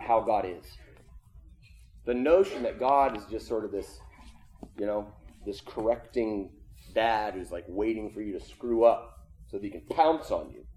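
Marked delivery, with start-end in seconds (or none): none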